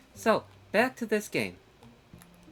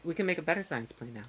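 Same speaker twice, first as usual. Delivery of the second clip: normal talking voice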